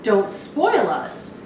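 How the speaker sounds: normal talking voice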